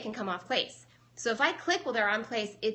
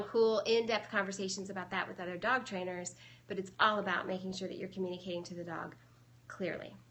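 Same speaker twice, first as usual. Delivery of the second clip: ordinary delivery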